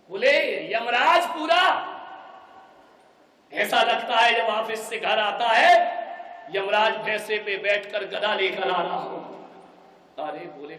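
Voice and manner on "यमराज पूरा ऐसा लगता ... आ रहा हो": scornful outburst. Acted. Daughter mocks her husband.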